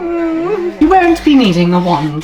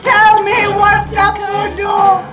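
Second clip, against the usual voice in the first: furious shouting; 6 semitones higher than usual. Acted. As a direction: bark the demands at him. Umbridge interrogates Harry.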